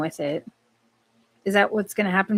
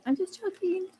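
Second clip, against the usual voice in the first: "I'm just joking" said much higher and softer; squeaky playful; 11 semitones higher, 9 dB quieter than usual. Real, not acted.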